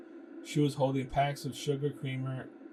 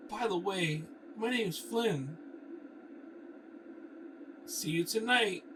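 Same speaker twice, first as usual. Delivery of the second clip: flirty character; 7 semitones higher, slower than usual. Acted. Flirty goodbye to Bianca.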